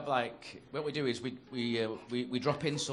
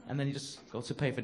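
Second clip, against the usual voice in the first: ordinary talk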